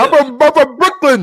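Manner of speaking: high, loud quoted protest; mock indignant retort. Quotes the insulted character's retort.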